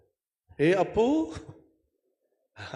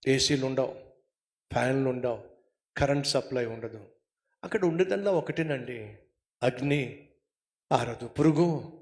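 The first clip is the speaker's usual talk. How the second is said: ordinary talk, nothing marked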